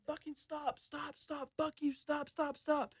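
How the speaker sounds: high-pitched mock yell, held back in volume; retold scream. Man thrown against a fence begging guards off, mock screaming. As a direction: repeat it like a scaled-down panicked scream. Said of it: "and I'm screaming".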